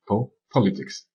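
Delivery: "politics" with a strong, stretched PO; "politics" stressed as PO-li-tics, slowly; stress on first syllable. Shown as a demonstration. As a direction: hit PO in "politics", then let the rest drop. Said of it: "l'accent est sur la première syllabe".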